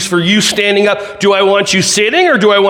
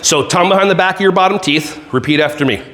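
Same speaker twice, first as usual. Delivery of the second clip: ordinary talk, nothing marked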